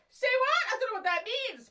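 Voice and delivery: high, loud "say what?" outburst; baffled. Retold or imitated. Pupil baffled by strange words, loud confused outburst. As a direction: blurt it out, totally lost. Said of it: "half of them you're like".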